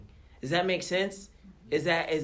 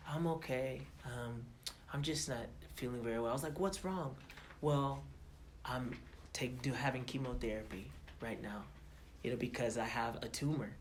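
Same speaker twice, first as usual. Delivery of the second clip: soft quoted reply; 3 semitones lower, 9 dB quieter than usual. Retold or imitated. Quoting the sick singer.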